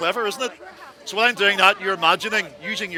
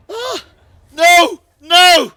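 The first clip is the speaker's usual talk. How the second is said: high loud scream; dying scream; 10 semitones higher, 12 dB louder, much slower than usual. Pretend. Passenger screaming as the plane crashes. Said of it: "this is where I come in".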